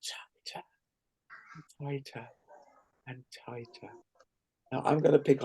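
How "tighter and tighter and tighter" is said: whispered build-up. Shown as a demonstration. Laughter yoga, winding up tension.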